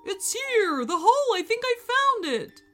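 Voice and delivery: high, loud calling out; excited shout. A narrator's reading. Bobby finds the hole and calls Ethan, excited shout. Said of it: "Bobby let out a shout".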